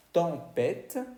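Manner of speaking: "tempête" as ton-PET, nasal first vowel; French pronunciation. Shown as a demonstration. Teacher translating tempestad, French "tempête". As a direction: say "tempête" with nasal start and crisp final T. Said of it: "Tenemos tempestad".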